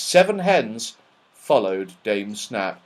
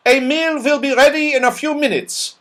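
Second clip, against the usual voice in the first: put-on stern old dame; 13 semitones higher, 4 dB louder than usual. Dame Snap answering Joe.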